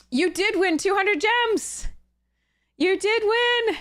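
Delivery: high, slow, sing-song prize call; excited announcement. Real, not acted. Streamer telling a chat viewer they won gems, high and drawn out. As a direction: stretch it out like a game show reveal.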